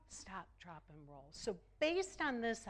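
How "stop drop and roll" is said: soft echo. Shown as a demonstration. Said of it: "What do we teach our kids to do?".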